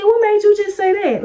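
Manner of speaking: high-pitched, puzzled retort; confused. Retold or imitated. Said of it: "I'm so confused".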